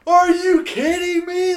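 high excited yell; retold yell. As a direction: shout it in disbelief, half laughing. Like, yelling in the class.

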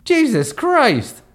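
high, slow panicked cry; alarmed. Acted. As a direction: shriek at the sharks. Terrified at sharks.

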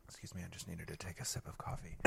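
fast soft whisper; whispered aside. Real, not acted. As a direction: whisper it quickly. Quick aside after sipping coffee.

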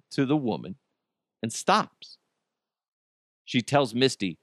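ordinary delivery

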